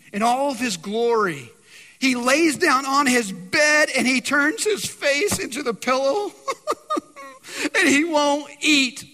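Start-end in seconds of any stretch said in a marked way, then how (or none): 2.0-9.1 slow, high, hammed-up blubbering; mock crying. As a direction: wail it slowly like a sulking big baby. Mock sulking king crying into pillow.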